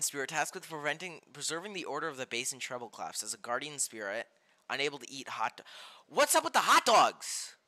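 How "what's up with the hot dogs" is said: loud, high, drawn-out disbelief; baffled outburst. Real, not acted. Streamer reading out odd game cards, baffled outburst. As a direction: demand it slowly and loudly, baffled.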